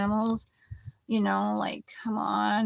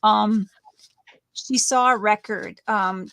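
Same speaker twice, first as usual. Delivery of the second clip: ordinary delivery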